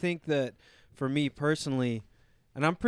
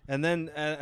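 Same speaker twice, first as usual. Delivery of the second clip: ordinary talk, nothing marked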